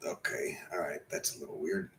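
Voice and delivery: breathless whisper to himself; real whispered aside, half to himself. Real, not acted. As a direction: drop to a private whisper.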